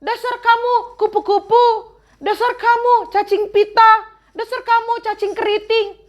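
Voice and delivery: high mocking insults; name-calling taunt. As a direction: jeer like a rude child.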